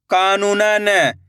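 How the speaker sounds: syllables joined into one slow word; blended word. Shown as a demonstration. Teacher joining the syllables for learners, slowly blended. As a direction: read it as one whole, stretched word. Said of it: "इनको आपस में लाकर पढ़ना है".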